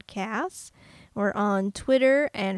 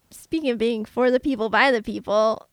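ordinary talk, nothing marked

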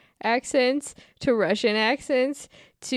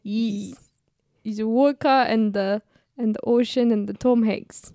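hammed-up Russian accent. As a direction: grope through a mock Russian accent. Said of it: "do the Russian accent".

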